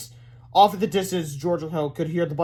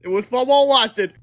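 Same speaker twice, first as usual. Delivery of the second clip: high, loud parting words; boy's farewell; 8 semitones higher, 8 dB louder than usual. A narrator's reading. Boy facing death signs off, high and loud. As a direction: call it out as a last goodbye. Doomed boys say goodbye.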